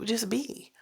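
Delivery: soft breathy whisper of the advice; hushed mystical whisper. Retold or imitated. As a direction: whisper "Just be" like a secret. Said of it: "That's how they say it too".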